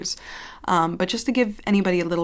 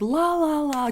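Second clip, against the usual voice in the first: high squeaky la la; SpongeBob singsong; 10 semitones higher, slower than usual. Retold or imitated. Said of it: "he always, like, imitates".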